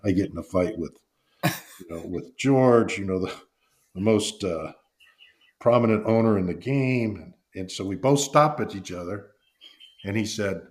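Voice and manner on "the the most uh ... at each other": ordinary delivery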